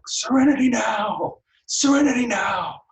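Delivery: stressed-out yelling. Retold or imitated. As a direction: yell the calming mantra like a furious father at his limit. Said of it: "starts yelling it when he's angry".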